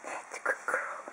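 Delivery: whispered soft praise; whispering. Real, not acted. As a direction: breathe the praise to the dog.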